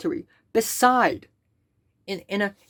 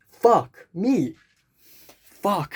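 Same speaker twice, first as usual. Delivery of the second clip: ordinary talk, nothing marked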